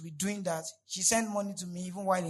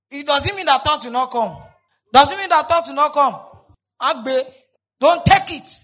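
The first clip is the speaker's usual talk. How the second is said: loud, emphatic preacher's delivery; full-force preaching; 4 semitones higher, 14 dB louder than usual. Real, not acted. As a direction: drive each warning home at high volume.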